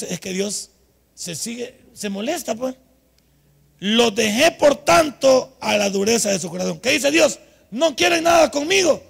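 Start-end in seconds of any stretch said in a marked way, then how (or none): 3.8-9.0 loud, raised scripture declamation; forceful preaching. Acted. Preacher quoting God to the congregation, forceful declamation. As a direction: proclaim God's words at full force.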